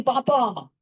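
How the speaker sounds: high, delighted father; mock delighted father; proud father. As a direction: hear the baby say papa with joy. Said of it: "he's saying".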